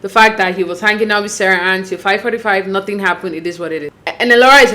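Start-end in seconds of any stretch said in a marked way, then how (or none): none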